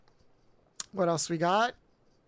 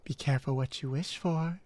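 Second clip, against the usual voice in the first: mommy voice; 6 semitones lower than usual. Pretend. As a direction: tease the chatter as a seductive mommy. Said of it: "I have to do mommy voice".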